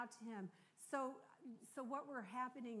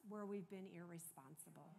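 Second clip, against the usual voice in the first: earnest prayer; 4 dB louder than usual. Real, not acted. Leads the congregation in prayer.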